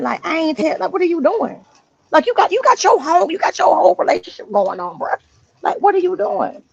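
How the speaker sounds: imitating his own irritated outburst; mild retold anger. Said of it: "And I got mad".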